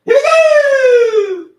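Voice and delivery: high, loud, slow announcement; excited. Real, not acted. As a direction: cheer it like a game show. Starting laundry task.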